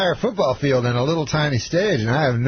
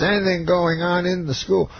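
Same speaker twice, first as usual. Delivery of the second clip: higher-pitched imitation; Bill Cosby; 6 semitones higher than usual. Star comedian asking a student beside him, impersonated. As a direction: lift the question as the famous comedian. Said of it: "He says".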